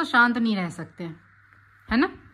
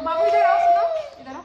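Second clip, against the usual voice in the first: high, louder call across the room; 5 dB louder than usual. Real, not acted. Mother calls her toddler to come over, high raised call. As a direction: call the little one over, voice lifted high.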